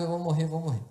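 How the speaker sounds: ordinary talk, nothing marked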